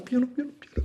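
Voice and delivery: high, anguished plea to go gently; high pleading cry; pained pleading. Acted. Begging for gentleness.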